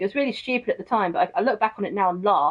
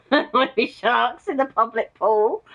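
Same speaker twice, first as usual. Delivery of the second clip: slow, higher, laughing through the words; laughing disbelief; 4 semitones higher, 3 dB louder, slower than usual. Real, not acted. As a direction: repeat it while still giggling, slowly. Laughing at the sharks idea.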